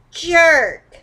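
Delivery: high, loud jab of a word; angry name-calling. Shown as a demonstration. Scolding the ex who jilted her, a loud angry jerk. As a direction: spit out jerk at the ex. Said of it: "call you some bad names like".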